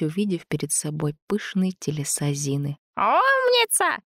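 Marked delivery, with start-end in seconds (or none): 3.0-4.0 very high, slow happy squeal; cheerful squeal. A narrator's reading. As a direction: squeal it out gleefully. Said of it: "взвизгнула Зина весело".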